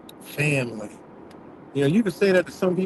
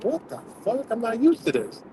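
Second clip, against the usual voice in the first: baffled; 7 semitones higher than usual. Retold or imitated. Retold baffled reaction.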